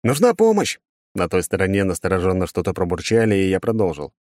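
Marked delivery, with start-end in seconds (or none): 0.0-0.8 high, raised-voice plea for help; urgent exclamation. Acted. Hero asking for help over the phone, exclaiming urgently. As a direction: burst out with it, pitched far up. Blurted request on a phone call.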